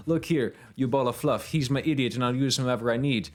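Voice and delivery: put-on Jamaican accent, scolding; playful Jamaican-accented telling-off. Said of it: "I don't know why she's Jamaican too now".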